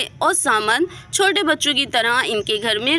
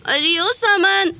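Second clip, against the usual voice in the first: high, slow searching call; mock shout through the house; 6 semitones higher, slower than usual. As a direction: stretch the name out to someone out of sight. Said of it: "क्यों इतनी तेज तेज चिल्ला रही हो".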